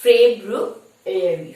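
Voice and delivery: ফেব্রুয়ারি drawn out slowly, Feb-ru-a-ry; slow clear correct ফেব্রুয়ারি; correct pronunciation. Shown as a demonstration. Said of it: "it is".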